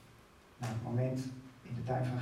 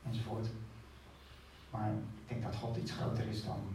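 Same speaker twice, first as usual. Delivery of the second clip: ordinary talk, nothing marked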